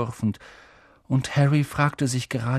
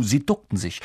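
plain reading voice

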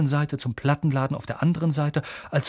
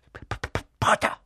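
stuck on breathy first consonants; stammering. A narrator's reading. Nervous professor greeting Harry, stammering. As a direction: struggle through repeated puffs before the word. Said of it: "stammelte Professor Quirrell".

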